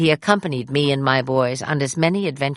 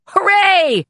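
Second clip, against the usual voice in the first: big cheer, far higher and louder; cheerful shout; 6 dB louder than usual. A narrator's reading. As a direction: shout with glee across the room. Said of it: "saw me and shouted".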